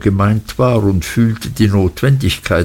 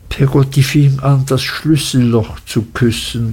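ordinary delivery

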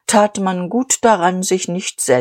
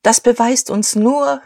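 raised, higher voice to the hall; calling out; 6 semitones higher than usual. Acted. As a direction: call it out over the crowd. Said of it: "rief Dieterich". (laut ausgerufen)

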